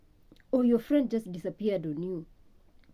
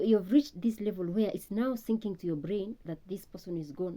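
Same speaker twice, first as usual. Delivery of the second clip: ordinary talk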